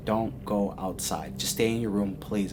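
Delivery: low, soft, earnest warning; grave plea. Retold or imitated. Lady begs the guest to stay inside, hushed and grave. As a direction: plead quietly and gravely.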